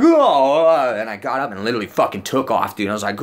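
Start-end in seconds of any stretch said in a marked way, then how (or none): 0.0-0.9 loud, high cry of fright; re-enacted scare, hammed-up; startled yell. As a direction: yelp as if jumped from behind. Acting out his startled yell.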